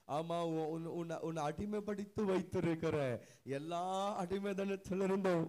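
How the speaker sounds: loud sermon cry, building phrase by phrase; impassioned preaching. Acted. Preacher voicing the Lord to the one he redeemed, impassioned preaching. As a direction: build to a full-force shout on the last words.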